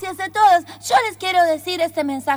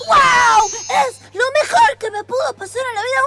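excited; 6 semitones higher than usual. Acted. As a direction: burst out with joy, speeding up. Amazed by Ashira's video.

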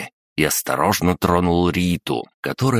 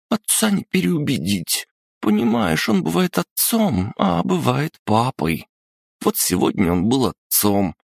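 put-on much higher, hopeless little girl; a sniffling princess who's lost hope; high tearful girl; 9 semitones higher than usual. Rita sobbing, hopeless.

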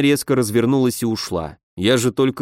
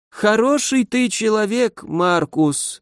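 put-on slow, higher slurred drunk mumble; drunk slurring; 9 semitones higher, slower than usual. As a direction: mumble it with a heavy drunken tongue. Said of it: "заплетающимся языком пробормотал Ганс".

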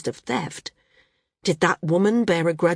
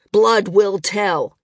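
slow, loud and spiteful; 2 semitones higher, 6 dB louder, much slower than usual. A narrator's reading. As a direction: drag out the taunt. Arak glaring, spiteful.

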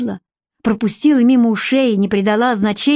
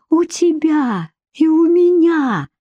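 eager insistence; 8 semitones higher, slower than usual. Acted. Emma insisting to Andrey.

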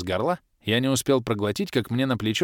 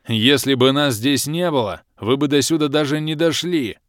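growled; 5 semitones higher, 6 dB louder than usual. A narrator's reading. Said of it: "рыкнул Алый Барс".

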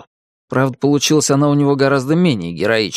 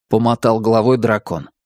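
plain reading voice

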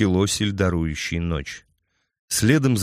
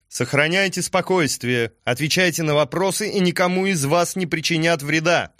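shouting; 8 semitones higher than usual. A narrator's reading. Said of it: "крикнула Аллерия".

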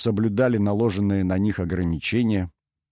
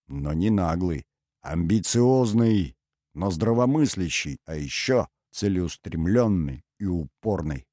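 usual speaking voice

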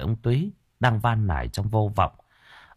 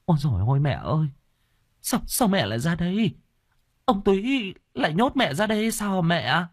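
high-pitched, dismayed outcry; shocked daughter-in-law, pitched way up; alarmed exclamation; 9 semitones higher than usual. Acted. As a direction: cry out in distress at finding mother-in-law. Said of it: "Bà thốt lên".

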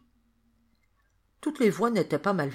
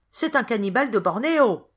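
high, loud narrated shout; crowd's cry; 6 semitones higher, 7 dB louder than usual. A narrator's reading. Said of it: "les gens criaient".

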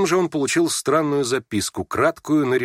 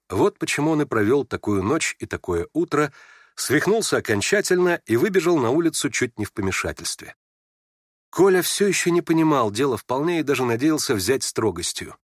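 ordinary delivery, nothing marked

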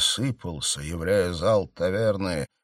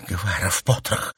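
hissing. A narrator's reading. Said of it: "зашипела она".

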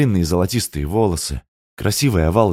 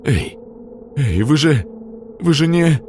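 alarmed; 8 semitones higher than usual. A narrator's reading. Sergei protests.

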